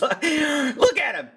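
laughing. Real, not acted. Mocking man in shorts.

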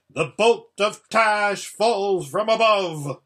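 loud, high, drawn-out war cry; grand cry. A narrator's reading. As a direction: cry it out slowly and grandly, meant to sound dreadful.

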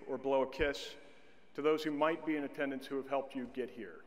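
ordinary delivery

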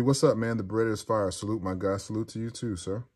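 ordinary delivery

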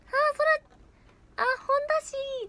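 very high, loud put-on voice; high-pitched mock gushing; ななみちゃん impression. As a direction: mimic a friend in a squeaky high register. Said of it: "ななみちゃんのモノマネ".

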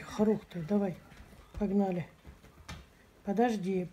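ordinary delivery

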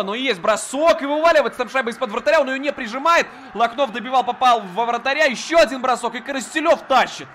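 loud, high, rising rush call; excited play-by-play. Real, not acted. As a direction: build up to the shot. Chance at the net, live call. (взволнованный репортаж у ворот)

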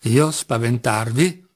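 usual speaking voice

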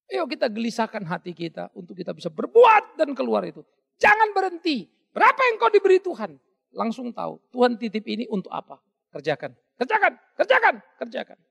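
rally them to keep working; building preacher's exhortation; fiery preaching. Acted. Preacher urging the congregation.

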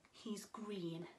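whispered riddle clue; hushed clue. Acted. Swim teacher whispering a clue to children. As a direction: whisper it like a secret for kids.

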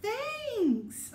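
high, loud bright thanks; bear's thanks. Acted. Bear thanks Hare for the muffins, bright and high. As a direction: answer for the kids as Bear. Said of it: "what's he say?".

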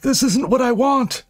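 very high, anguished begging; desperate high-pitched apology. A narrator's reading. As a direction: beg helplessly in a high strained voice. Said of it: "he begged".

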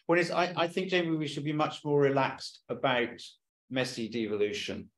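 normal talking voice

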